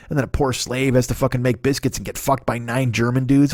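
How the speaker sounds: normal talking voice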